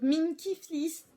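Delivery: "Red" said the English way, not French; a playful English accent on "Red" in a French sentence. Shown as a demonstration. Vlogger naming a fabric for viewers, in a playful English accent. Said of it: "Vous avez vu l'accent?".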